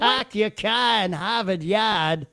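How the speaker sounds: mock Boston accent, dropping the R's; hammed-up Boston "pahk yah cah". Host introduces the third-place accent, mock Boston accent. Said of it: "the Boston accent".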